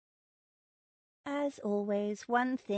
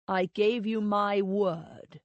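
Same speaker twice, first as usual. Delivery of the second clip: slow, hard and louder, pressing on gave; flat refusal to say more, slowly; 4 dB louder, slower than usual. A narrator's reading. Husband answers his wife's plea for a promise in a slow, hard voice. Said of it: "He said in a hard voice".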